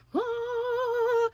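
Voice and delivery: high drawn-out impressed "Whoa"; stunned whoa. Retold or imitated. Seeing Kylie open the door looking good, high stunned whoa. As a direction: let out a long high amazed whoa.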